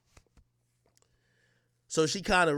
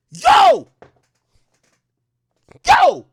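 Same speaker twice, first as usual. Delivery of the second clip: very high, loud call of Yo; yelling out; 13 dB louder than usual. Retold or imitated. Narrator shouting Yo at the guy pouring liquor. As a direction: call out across the loud club. Calling out at the club.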